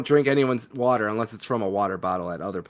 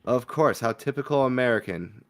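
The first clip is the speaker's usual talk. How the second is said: usual speaking voice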